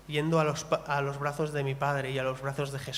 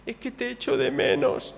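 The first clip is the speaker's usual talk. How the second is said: higher tearful child voice, mock weepy; imitating crying daughter; 7 semitones higher than usual. Father retelling his five-year-old's goodbye, imitating her tearful voice. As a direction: mimic a little girl missing her dad. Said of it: "llorando así".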